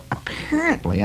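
"apparently" jumps high and falls. Shown as a demonstration.

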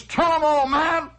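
imitated French ham cheering; cheering Frenchman. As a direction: goad him on like a French ham. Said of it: "it is a guy in France".

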